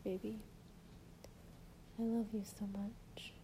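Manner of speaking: ordinary talk, nothing marked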